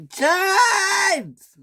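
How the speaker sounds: Henry screaming at James. Acted. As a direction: shriek the name in shock. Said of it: "screamed Henry".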